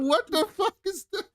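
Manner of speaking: high, disbelieving cry of what the fuck; shocked outburst. Retold or imitated. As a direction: pitch jumps way up in stunned disbelief. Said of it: "I said".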